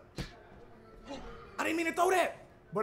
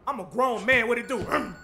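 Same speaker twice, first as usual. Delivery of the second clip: normal talking voice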